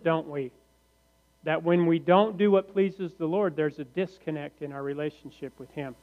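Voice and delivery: normal talking voice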